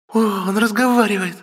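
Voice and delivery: put-on high, delighted girlish cry; joyful exclamation. A narrator's reading. Sister hears baby talk, high joyful exclamation. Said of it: "радостно воскликнула Ая".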